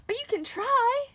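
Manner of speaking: high, loud coaxing; eager urging. Retold or imitated. Friend insisting her Korean will do, eagerly urging. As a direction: mimic a friend pushing you to go for it.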